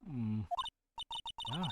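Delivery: ordinary talk, nothing marked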